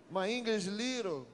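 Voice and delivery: lightly put-on Brazilian broken English, slow; self-mocking broken English, told to the church. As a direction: play a humble Brazilian fumbling in English. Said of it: "o meu inglês é meio britânico".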